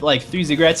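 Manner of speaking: usual speaking voice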